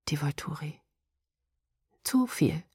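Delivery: breathless whispered name; a horrified whisper to herself; stunned whisper. Acted. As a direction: whisper it in dread.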